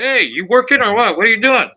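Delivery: playful imitation of a friend's hello; mock casual greeting. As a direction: call out like a buddy in a store. Retold greeting in store.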